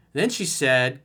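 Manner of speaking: normal talking voice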